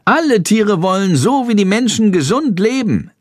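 high, chirpy chatter; chattering bird. A narrator's reading. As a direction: Schandor babbling happily to Jendrik. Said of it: "plappert Schandor". (hohes, plapperndes Vogelstimmchen)

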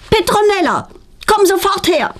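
high-pitched stern summons; calling out. A narrator's reading. Mother summoning daughter from the living room, calling out high. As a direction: call her over high and urgent. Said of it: "ruft ihre Mutter laut".

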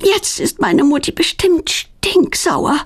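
high, winded dismay; panting. A narrator's reading. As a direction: gasp it out after a getaway. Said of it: "keucht Claudia".

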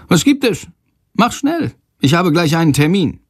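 high, impatient voice; hurried headmaster. A narrator's reading. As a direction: brush the boy off, busy. Headmaster rushing the boy.

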